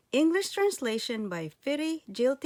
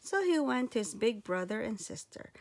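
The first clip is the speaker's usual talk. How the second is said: plain reading voice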